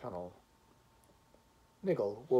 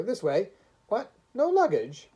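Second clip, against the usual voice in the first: loud, high barked questions and orders; astonished, then briskly bossy; brusque porter; 4 semitones higher, 8 dB louder than usual. A narrator's reading. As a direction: bustle them along loudly.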